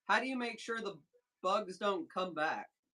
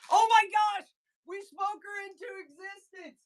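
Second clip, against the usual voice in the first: much higher and louder, a squeal of delight; thrilled surprise; 11 semitones higher, 7 dB louder than usual. Real, not acted. Greeting the plant guest who just showed up, real excited squeal. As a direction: shriek it out as the guest appears. The guest joins the live stream.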